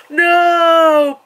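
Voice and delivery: long high drawn-out no; anguished cry. Retold or imitated. Movie moment, a long high anguished no. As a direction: cry out in despair. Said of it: "it's just like".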